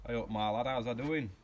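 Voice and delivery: loud, broad Yorkshire; broad Yorkshire accent. Shown as a demonstration. Demonstrating accents for viewers, loud broad Yorkshire accent. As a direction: greet a lad in hearty put-on Yorkshire. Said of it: "I could do broad Yorkshire".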